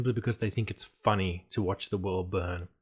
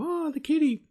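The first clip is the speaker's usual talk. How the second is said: cooing over a kitty; 4 dB louder than usual. Retold or imitated. Players cooing over mutant animal.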